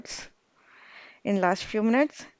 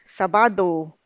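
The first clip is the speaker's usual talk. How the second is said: Sabado with the first sa louder; stress on sa; 4 dB louder than usual. Shown as a demonstration. Teacher showing the stressed A in Sabado, clear stress. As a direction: lean on the first syllable of Sabado. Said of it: "So we'll have to give the stress".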